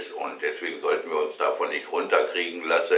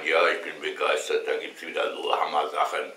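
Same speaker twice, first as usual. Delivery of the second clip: ordinary talk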